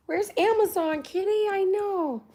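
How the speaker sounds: high sing-song pet voice. Real, not acted. Chatting to her cat about a late parcel in high baby talk. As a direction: coo up high at the kitty.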